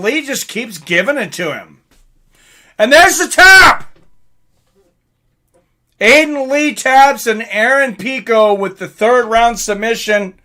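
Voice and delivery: ordinary delivery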